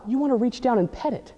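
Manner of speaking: ordinary delivery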